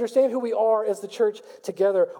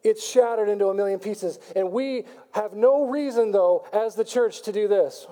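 ordinary talk, nothing marked

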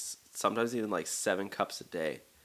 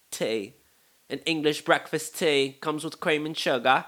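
higher, louder, emphatic fake British voice; horrible British accent; 5 semitones higher, 6 dB louder than usual. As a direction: lecture as an insistent British tea purist. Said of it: "sorry for this horrible accent".

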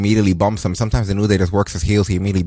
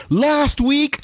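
mock innocent question. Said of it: "is like".

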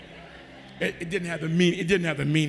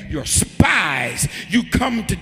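accusing Joseph; 8 dB louder than usual. Acted.

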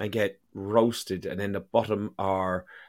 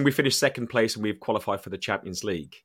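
normal talking voice

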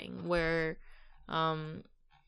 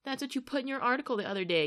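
angry retort; 7 semitones higher, much faster than usual. Retold or imitated. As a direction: throw the line back angrily. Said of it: "Phil Neville just spits back".

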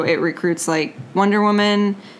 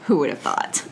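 whispered laugh; 3 semitones lower, 5 dB quieter than usual. Real, not acted. Laughing echo of a joke.

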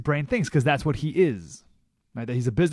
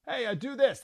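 boss order; 10 semitones higher, 4 dB quieter than usual. Retold or imitated. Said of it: "That's how he gets stuff done".

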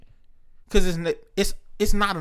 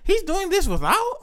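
much higher, amazed mock voice; mock amazed self; 12 semitones higher than usual. Quoting himself hearing the story, high and amazed. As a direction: squeal it in disbelief. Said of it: "I'm like".

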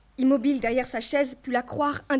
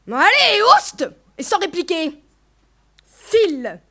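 loud, raised sharp command; angry dismissal; 5 semitones higher, 8 dB louder than usual. A narrator's reading. Mother pushing daughter out by shoulders, angry dismissal. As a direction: order her out with a shout.

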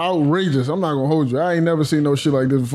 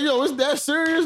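mock disbelief; 9 semitones higher than usual. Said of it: "I'm like".

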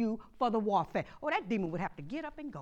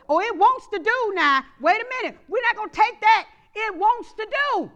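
loud, higher preacher's cry, rising to a shout; preaching at full force; 10 semitones higher, 12 dB louder than usual. Acted. Pastor rallying the congregation against the enemy, shouting. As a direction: shout down the trouble like a fired-up pastor.

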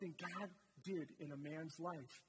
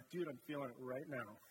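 lower, laid-back mock protest; caught up in it; 6 semitones lower than usual. Worshipper telling the impatient friend he's feeling it, low and laid-back. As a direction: brush off the clock, you're in the moment. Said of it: "You're like".

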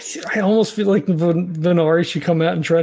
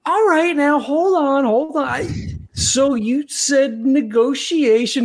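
higher-pitched, stalling plea to hold on; placating negotiator; 7 semitones higher, slower than usual. Acted. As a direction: talk someone down, pitched up and drawn out at the end. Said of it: "and he goes".

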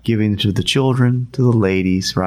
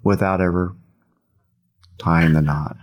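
slow, drawn-out question sinking at the end; deliberate, spaced-out challenge; real slow; 3 semitones lower than usual. Retold or imitated. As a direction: leave long pauses between each phrase. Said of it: "he says it just like that, real slow".

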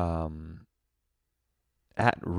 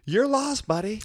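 mock tough guy. Mocking a rude guy.

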